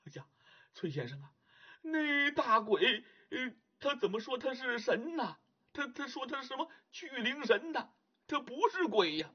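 a hint of a nervous emperor, questioning; frightened emperor. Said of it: "就问崔判官".

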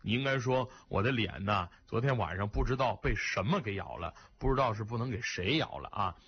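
put-on elderly man kindly corrects the young woman; kindly older man. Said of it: "非常好意地纠正说".

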